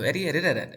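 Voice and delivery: "Eddie edited it" with D and T tapped; sounds like R. Shown as a demonstration. Teacher showing how it sounds, "Eddie edited it" with taps. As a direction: link "Eddie edited it" with quick flaps. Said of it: "Así suena".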